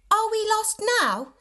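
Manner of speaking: put-on high, worried little girl's question; little girl asking. A narrator's reading. Little sister asks if they're lost, high and worried. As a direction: voice a scared small sister asking.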